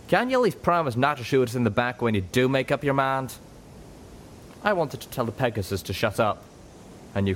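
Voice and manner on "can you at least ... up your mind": a light drawling cowpony, sour and sarcastic; lightly voiced cowpony, fed up; sarcastic Calamity. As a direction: needle them with a sour jab.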